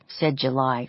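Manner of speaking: ordinary delivery, nothing marked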